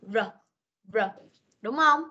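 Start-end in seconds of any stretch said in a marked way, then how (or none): 0.0-1.0 "R" as its short phonic sound; letter sound demo. Shown as a demonstration. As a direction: sound out "R" briefly, twice. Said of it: "phát âm là".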